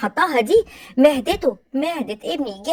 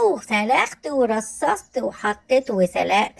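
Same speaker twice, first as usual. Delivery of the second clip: slow listing; 3 semitones lower, slower than usual. Acted. Listing her labours emphatically.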